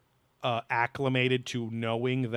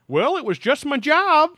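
much higher and louder, dismissive mock voice; mock nonchalant witness; 14 semitones higher, 10 dB louder than usual. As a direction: parody someone shrugging it off, high and loud. Mocking the witness's casual tone.

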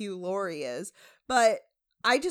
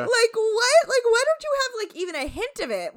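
mock disbelief; 12 semitones higher, 7 dB louder than usual. Retold disbelief, lively.

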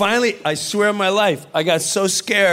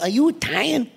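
Joe Pesci impression; 5 semitones higher than usual. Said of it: "That's an incredible impression".

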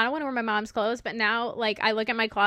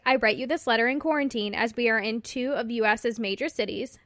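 normal talking voice

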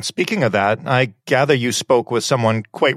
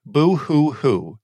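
fake crying; 3 semitones higher, much slower than usual. Letter writer mocks the crying.

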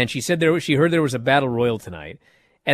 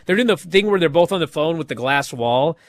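usual speaking voice

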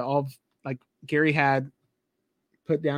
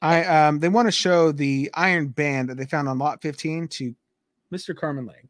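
ordinary talk

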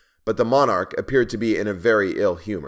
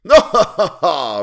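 high, very loud "ha, ha, ha"; roaring laugh; 8 semitones higher, 12 dB louder, slower than usual. A narrator's reading. Tyrant roaring at the dwarf drinking, huge acted laugh. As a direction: bellow out each laugh as the king. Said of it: "roared the latter".

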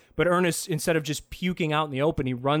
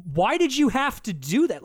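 exasperated; 9 semitones higher, 4 dB louder than usual. Retold or imitated. Exasperated rhetorical question.

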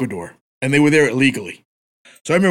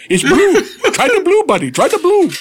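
higher, louder pushy clerk impression; hammed-up clerk voice; 4 dB louder than usual. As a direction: push the blue drink like an eager clerk. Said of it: "I said".

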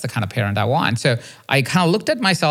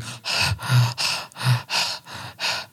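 imitated heavy panting; a run of short, soft, airy puffs; out of breath; 6 dB quieter than usual. Dad winded from playing tag, acting out breathless panting. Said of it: "I find myself out of breath".

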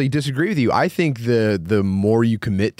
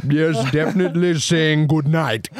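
put-on pitched-up comic Indian accent; 4 semitones higher than usual. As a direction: sign off in a hammed-up Indian accent. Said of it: "American Indian history accent".

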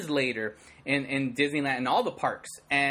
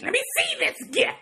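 much higher, haughty queen voice; imperious queen. Retold or imitated. Evil queen demanding the hero's gift, haughty and high. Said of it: "He's like".

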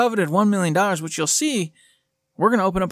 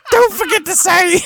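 higher, louder hammed-up Irish accent; 12 semitones higher, 6 dB louder than usual. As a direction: belt the catchphrase in a cartoon Irish accent. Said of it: "Irish accent day".

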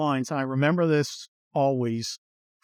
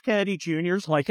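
ordinary delivery

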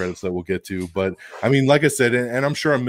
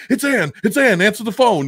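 high, loud frantic Venom urging; mock panic; 11 semitones higher, 6 dB louder than usual. Venom urging Eddie to answer Anne's call, high mock panic. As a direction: blurt it out in a rush of panic.

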